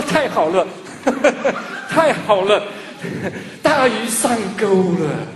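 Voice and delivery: laughing through it, drawn out at the end; gloating laugh as the child takes the bait; gleeful laughing. Retold or imitated. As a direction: chuckle with delight at the trick. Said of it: "我说".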